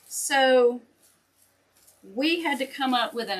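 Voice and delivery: normal talking voice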